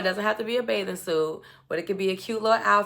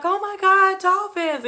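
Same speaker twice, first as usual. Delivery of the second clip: high, louder mock-excited exclamation; excited squeal, imitated; 12 semitones higher, 5 dB louder than usual. Woman spots dolphins on the yacht, imitated excitement. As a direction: gush it like a thrilled girlfriend. Retelling her delight at dolphins.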